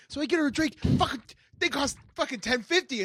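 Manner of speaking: high, loud furious retelling; mock outrage over the bill; livid rant. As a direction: rant like he got ripped off. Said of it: "comes back fucking livid".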